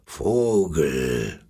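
low whispered lion voice. Acted. Lion asks the robin, low and hushed. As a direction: breathe it low like a big cat.

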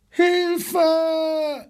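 high, panicked cry of help; zebra screaming for help. A narrator's reading. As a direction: shriek it like a frightened zebra. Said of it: "schrie in diesem Augenblick das Zebra". (hoher, panischer Hilferuf des Zebras)

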